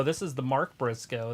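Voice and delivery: ordinary talk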